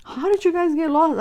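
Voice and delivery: high, loud incredulous brother; amused disbelief. Retold or imitated. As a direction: imitate him cracking up at you. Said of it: "he's just laughing".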